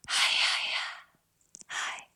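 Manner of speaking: breathy whispered hi, no voice; whispered call. Shown as a demonstration. Same mountain call to a friend, now whispered. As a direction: push breath out through an open throat. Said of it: "whisper the call".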